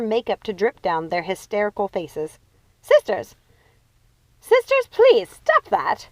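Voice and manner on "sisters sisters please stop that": high, loud exasperated scream; loud pleading shout. A narrator's reading. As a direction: shriek to stop them. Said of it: "screamed Lucinda".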